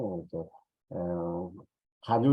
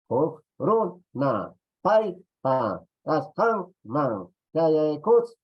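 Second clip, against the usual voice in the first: each syllable loud and higher, set apart; stressed syllables; 6 semitones higher, 5 dB louder, much slower than usual. Shown as a demonstration. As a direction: drum out the word beats. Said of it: "showing penultimate stress by syllables".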